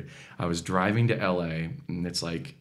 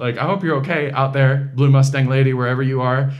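tender farewell; 5 semitones higher, 6 dB louder than usual. Retold or imitated. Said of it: "And I was just like".

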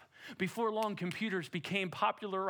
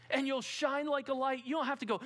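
ordinary talk

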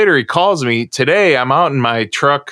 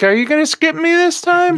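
high squeaky put-on voice, pitched way up; mock whiny plea. Podcast guest teasing the host who nearly skipped him, high squeaky mock whine. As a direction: play a kid afraid he's being left out. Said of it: "I was like".

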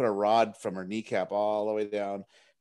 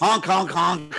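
silly clown; 8 semitones higher, 8 dB louder, slower than usual. Retold or imitated. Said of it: "It's like a clown".

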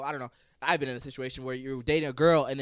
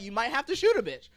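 punchline; 10 semitones higher, 5 dB louder than usual. Real, not acted. Escalating joke punchline.

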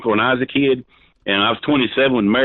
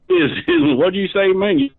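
much higher-pitched mimicking voice; mocking mimicry; 10 semitones higher, slower than usual. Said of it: "I'm just, just mimicking you".

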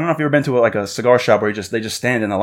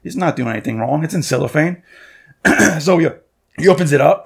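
ordinary delivery

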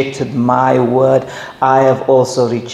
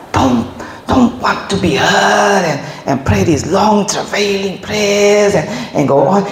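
preaching; 7 semitones higher than usual. Acted. Preaching quoted warning.

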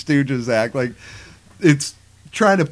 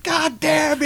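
mock anguished cry; 12 semitones higher, slower than usual. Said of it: "and Ash is like".

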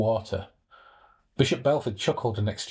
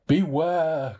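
a raised warning cry; 6 semitones higher, 4 dB louder than usual. A narrator's reading. Voice over the sea crying a warning. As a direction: call the warning across the water. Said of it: "cried the voice".

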